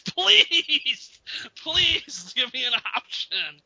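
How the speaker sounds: high, slow, laughing please. Real, not acted. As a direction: beg him while laughing. Pleading with Max, laughing.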